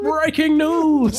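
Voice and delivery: high, slow, dramatic "breaking news"; drawn-out cartoon announcer voice; SpongeBob fish announcer. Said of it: "like the fish from SpongeBob".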